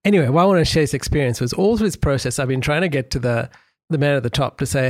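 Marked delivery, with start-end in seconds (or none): none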